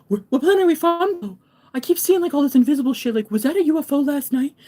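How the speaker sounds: high, loud mock panic; frantic imitation. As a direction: babble like a freaked-out believer. Imitates chaotic, frantic star seeds.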